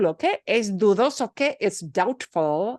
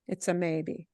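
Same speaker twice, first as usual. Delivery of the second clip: soft echo; 3 semitones lower, 7 dB quieter than usual. Shown as a demonstration. Teacher softly repeats the point to students. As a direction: lower and softer, pushing "a".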